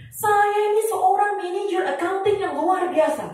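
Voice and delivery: high sing-song boastful voice; mimicking the speaker. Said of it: "ngomongnya gini".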